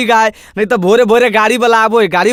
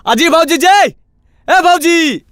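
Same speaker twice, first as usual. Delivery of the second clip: higher-pitched call with drawn-out ending; 4 semitones higher than usual. Acted. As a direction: call the sister-in-law out. Calling sister-in-law out of house. (भौजी के हाँक लगाते हुए)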